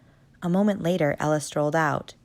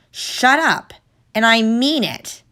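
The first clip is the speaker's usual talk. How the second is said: higher, louder snap; harder angry snap; 7 semitones higher, 7 dB louder, slower than usual. Acted. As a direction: threaten him for real. Guard snaps again, harder.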